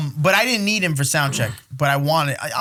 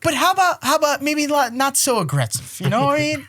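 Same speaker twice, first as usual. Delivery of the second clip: higher, gentle mock advice voice; imitating the promoter; 9 semitones higher than usual. Mimicking Kevin John, promoter, correcting the promo mildly. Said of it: "Kevin John's gonna be like".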